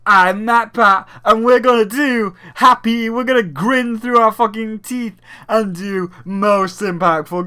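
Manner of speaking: high, loud, forced-grin awards host; mock cheery host. Mocking the Game Awards host, loud fake cheer. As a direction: ham up a fake-happy show presenter.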